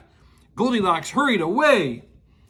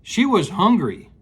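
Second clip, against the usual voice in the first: ordinary delivery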